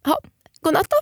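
high, pouty mock goodnight; a huffy, childish goodnight; defiant sulk. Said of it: "var så jävla trotsig".

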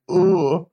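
high choked ugh, mouth full; a strangled high groan of dismay; disgusted groan. Retold or imitated. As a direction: ugh with the curry still in her mouth. Chicken curry in mouth.

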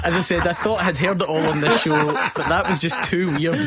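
laughing through the words; can barely get it out for laughing; host cracking up at the audiobook clip; laughing in disbelief. Real, not acted.

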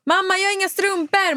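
put-on high-pitched child calling for mum; imitated demanding child. Mother imitating child who lacks socks, high demanding call. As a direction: mimic a kid calling out a complaint.

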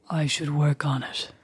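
slow, put-on sultry deep voice; creepy low voice. Sister practising a sexy timbre, creepy low and slow. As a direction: say it slowly, dropped low and creepy. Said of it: "talking in that creepy voice".

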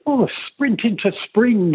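retold Irish-accent promo read; put-on Irish accent. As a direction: lay on the Irish for a voiceover. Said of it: "can you do it in an Irish accent".

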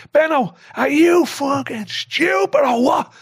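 high, slow, exasperated shout; roaring him down. Retold or imitated. Manager tearing into his keeper at halftime, drawn-out angry roar. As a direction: roar it out, stretching the words. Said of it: "So I'm roaring him down".

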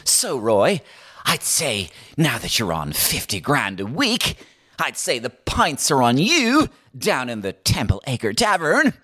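stiff, drawn-out interviewer impression; stilted chat-show host, slow and labouring; Pat Kenny. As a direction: stretch it out, pitch lifting at the end. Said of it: "stiff as a board as usual, trying to get conversation out of Roy".

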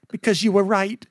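lightly voiced mother's grudging admission; retold mother's voice. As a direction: imitate mum conceding the point. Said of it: "And she said".

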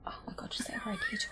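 breathless whispered laugh. Real, not acted. Scolding her friend through a whispered laugh. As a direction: giggle it out under her breath. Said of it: "You bitch".

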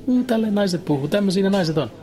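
high, squeaky mocking voice of a comedian; mock shrill voice. Comedian mocking women on stage, in a high shrill voice. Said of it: "Se vaan puhuu kimeällä äänellä".

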